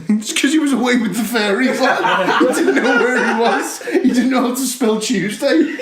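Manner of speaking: laughing mockery. Real, not acted. Joking with co-host about a dazed wrestler, laughing mockery. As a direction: crack up while making the joke.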